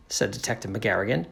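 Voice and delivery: plain reading voice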